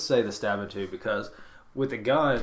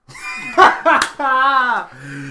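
loud high burst of laughter; loud laughing fit; 13 semitones higher, 11 dB louder than usual. Real, not acted. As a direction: crack up at the bad news. Counting remaining sodas.

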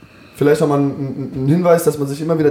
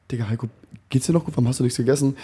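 much softer, telling himself off; inner voice; 5 dB quieter, faster than usual. Retold or imitated. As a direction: quietly scold yourself while lying in bed. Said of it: "plötzlich willst du eigentlich so einschlafen". (leise, sich selbst tadelnd)

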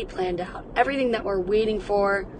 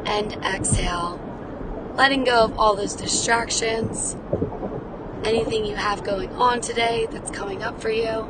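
guided breathing. Acted. Guides listeners through deep breaths.

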